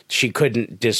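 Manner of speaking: ordinary talk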